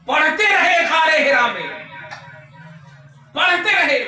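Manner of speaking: high, loud refrain; impassioned preaching cry. Real, not acted. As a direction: call it out to the whole crowd. (जोश में ऊँची पुकार)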